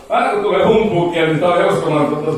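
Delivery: usual speaking voice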